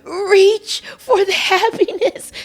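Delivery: mock-tragic sigh over happiness; mock dramatic. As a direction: overplay it like a tragic heroine reaching out. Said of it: "That's so dramatic".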